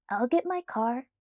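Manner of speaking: ordinary delivery, nothing marked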